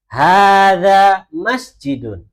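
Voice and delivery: slow, loud, drawn-out Arabic, every syllable of "Hada masjidun" stretched; slow model reading. Shown as a demonstration. Teacher modelling Arabic for the class, slow loud recitation. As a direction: chant it slowly for the class to repeat. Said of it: "Coba Bu Ajeng baca, yang lain ikutin".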